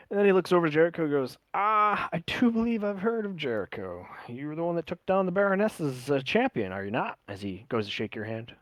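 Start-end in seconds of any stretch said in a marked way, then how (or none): 1.5-7.2 lightly voiced drow, pitched up as he recognises a fighter; a hint of courtly recognition; impressed drow host. Voices the drow meeting Jericho.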